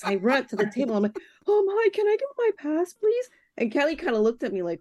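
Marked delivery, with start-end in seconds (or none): 1.5-3.3 high, breathless excited plea; squealing with fan excitement; eager fan. Retold or imitated. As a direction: beg like a giddy fan at the table. Eager fan begging at festival table.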